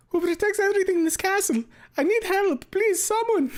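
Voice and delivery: very high, loud cartoon plea for help; Mario. Streamer reads Mario's plea from the fic, squeaky hammed-up distress. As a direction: squeal like a panicked cartoon plumber. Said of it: "I don't even know if I have a fucking Mario voice".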